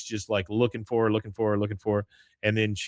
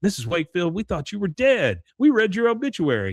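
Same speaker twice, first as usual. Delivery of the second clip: high-pitched imitation of a shocked woman; astonished exclamation; 9 semitones higher than usual. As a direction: exclaim in mock disbelief, pitch climbing. Voices woman astonished someone is alive.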